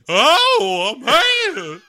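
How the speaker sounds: very high, loud cartoon farm-girl cry; Bugs Bunny. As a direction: wail it like a hillbilly cartoon girl. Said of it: "that voice that Bugs Bunny used".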